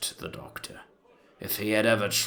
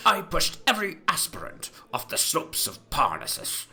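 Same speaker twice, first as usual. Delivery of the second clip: higher, mocking voice for Boswell; Boswell's complaint; 9 semitones higher than usual. A narrator's reading. Boswell complains of harshness toward new writers, mock high. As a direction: pitch it up, a teasing complaint. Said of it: "He said".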